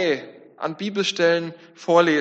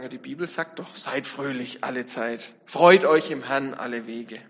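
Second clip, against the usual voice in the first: pious arguing; 6 semitones lower than usual. Retold or imitated. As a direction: quote the Bible piously to end the talk. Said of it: "ganz fromm argumentieren und sagen".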